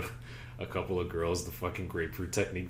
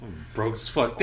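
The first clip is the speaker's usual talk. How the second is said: normal talking voice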